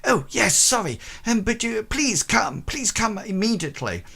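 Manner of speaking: high fussy housekeeper voice; high and hurried, pleading him to come; flustered housekeeper. Acted. Housekeeper begging Howard to come, high and fussy.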